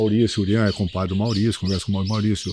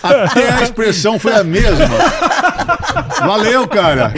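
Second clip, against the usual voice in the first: loud, high voice through laughter; laughing; 11 semitones higher, 7 dB louder than usual. Real, not acted. Father telling how his sons echoed each other, laughing loudly. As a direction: crack up while exclaiming, pitched way up. Cracking up at punchline of own story.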